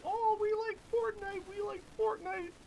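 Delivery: put-on high, loud excited kid voice. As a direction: squeal it like a hyped kid. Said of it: "kids being like".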